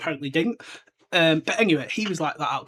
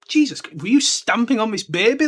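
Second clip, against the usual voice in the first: incredulous outburst; 6 semitones higher, 6 dB louder than usual. Real, not acted. Reacting to Vernon's line.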